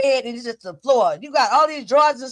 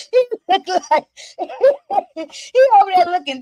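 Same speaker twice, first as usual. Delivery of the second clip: helpless giggles breaking up the words; laughing; 6 semitones higher, 3 dB louder than usual. Real, not acted. Owner laughing at the triggered-looking puppy, amused. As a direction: talk through real, high laughter.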